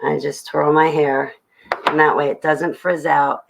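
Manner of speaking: ordinary delivery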